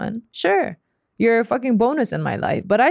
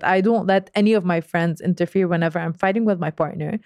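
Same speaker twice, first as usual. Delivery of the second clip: ordinary talk, nothing marked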